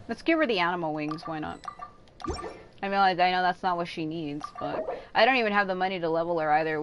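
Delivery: usual speaking voice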